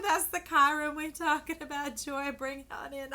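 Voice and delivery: high, loud hype call; excited mock reply. Viewers answering reader about Chiron, loud excited. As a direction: hype it up like a cheerleader. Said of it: "And you're like".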